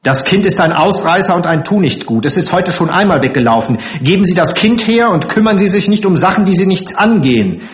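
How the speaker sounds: fast, high, loud tirade; angry scolding. Acted. Matron demands the runaway child back, angry rapid scolding.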